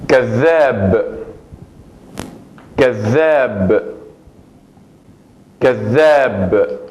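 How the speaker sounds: كذاب with the ذ held long and pressed; emphatic doubled dhal. Shown as a demonstration. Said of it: "tiene un punto más enfático". (la dhal de كذاب doble y enfática)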